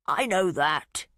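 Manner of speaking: slow, pointed hostility; a touch of an old man's annoyance; hostile. A narrator's reading. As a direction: bite it back at her, slowly. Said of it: "he said with hostility".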